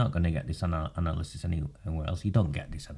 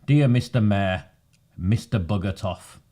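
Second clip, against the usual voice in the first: slow, louder formal read-out; formal letter reading; 3 semitones higher, 8 dB louder, slower than usual. Acted. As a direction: read it like an official notice. Reads a formal council letter.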